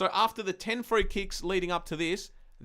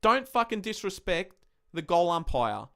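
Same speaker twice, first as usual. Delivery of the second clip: normal talking voice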